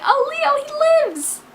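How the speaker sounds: very high-pitched gleeful outburst; overjoyed squeal. Retold or imitated. Reader reliving a peek at the last chapter, overjoyed squeal. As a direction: squeal the good news in delight. Re-enacts finding out Leo lives.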